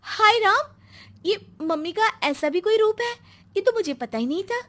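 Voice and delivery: stunned gasp. Acted. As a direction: blurt out utter amazement. Preeti stunned by mother-in-law's change. (हक्की-बक्की, ऊँची हैरान आवाज़)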